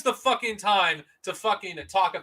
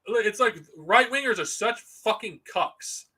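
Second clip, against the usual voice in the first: normal talking voice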